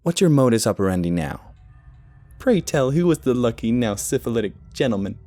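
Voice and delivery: high-pitched teasing jab; mocking chide. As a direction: needle them with a catty sneer. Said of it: "Michaela chided".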